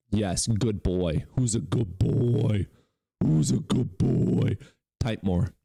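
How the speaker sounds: slow, sing-song talking to a pet; mock dog praise. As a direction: coo at the troll like a puppy. Taunting viewer like a dog.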